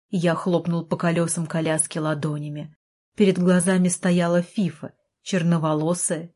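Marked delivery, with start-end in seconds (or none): none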